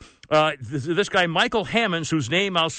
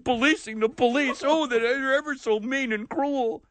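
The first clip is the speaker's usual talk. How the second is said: mocking whine; 6 semitones higher than usual. Mocking the complaining woman.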